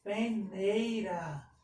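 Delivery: slow "Peneira", syllables stretched; syllable-by-syllable dictation. Shown as a demonstration. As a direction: dictate "Peneira" slowly for copying. Teacher dictates word by syllables.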